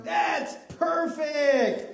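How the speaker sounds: high, drawn-out "perfect"; big slow shout of approval; delighted praise. Real, not acted. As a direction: cheer the performer on. Host praising the volunteer's attempt.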